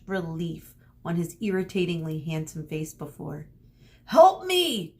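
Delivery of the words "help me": high, loud desperate shout. Acted. Perseus shouting for help across the gorge, loud desperate cry. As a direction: scream for help across the chasm. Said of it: "Perseus shouted".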